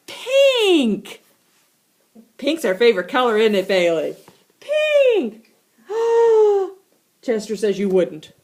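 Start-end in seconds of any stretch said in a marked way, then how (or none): 0.1-1.2 much higher, louder "pink"; gleeful high reveal; delighted squeal. Acted. 4.6-6.7 much higher, loud squeal; excited squeal then gasp; gleeful shriek. Acted. Delighted shout.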